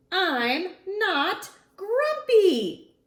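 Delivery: high, loud, slow grumpy shout. Acted. Monkey Jim shouting at his friends, loud and slow. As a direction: bellow it, dragging every word. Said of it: "shouted Jim as he beat his chest".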